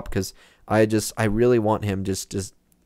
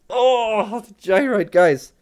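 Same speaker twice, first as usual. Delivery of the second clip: delighted squeal, higher; excited; 11 semitones higher, 4 dB louder, much slower than usual. Real, not acted. Gamer thrilled to spot the gyroid to viewers, excited and higher. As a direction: gush over the gyroid.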